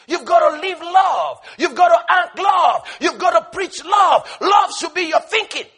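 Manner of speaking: loud, high, hammered repetition; full-force preaching; preaching shout. Real, not acted. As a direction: pound each phrase home. Preaching climax.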